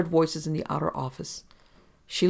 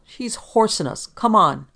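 loud, higher, drawn-out angry snarl; 6 semitones higher, 8 dB louder, slower than usual. A narrator's reading. Impatient policeman urging his partner on, snarling. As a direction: snarl it, building to a loud order. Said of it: "Dorigo snarled".

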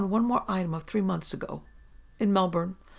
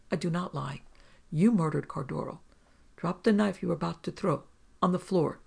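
plain reading voice

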